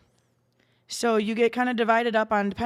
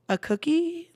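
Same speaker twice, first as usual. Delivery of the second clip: higher-pitched, puzzled echo; puzzled retold question; 6 semitones higher, slower than usual. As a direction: ask it as a baffled friend who misheard. Retells friend's confused mishearing.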